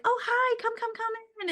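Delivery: high, sugary welcome at the door; high sing-song hostess hello; sing-song greeting. Retold or imitated. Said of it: "I was like".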